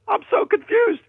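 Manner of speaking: high, loud put-on old lady voice; confused old lady. Caller mimicking his confused elderly neighbor, high and loud. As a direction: squeak it out like a bewildered grandma.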